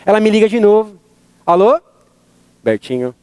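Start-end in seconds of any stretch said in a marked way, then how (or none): 2.6-3.1 low, soft, stern call of the name; angry mom on the phone; mom's angry voice. Retold or imitated. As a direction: say the name like a cross mother. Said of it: "Dona Ana com voz de brava".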